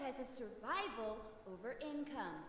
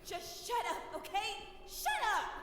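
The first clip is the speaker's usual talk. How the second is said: higher and louder frustrated yell; angry shout; 8 semitones higher, 5 dB louder than usual. Acted. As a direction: snap at them, losing control. Frustrated spirit snaps back.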